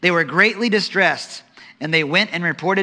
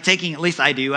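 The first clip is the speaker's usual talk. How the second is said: ordinary talk, nothing marked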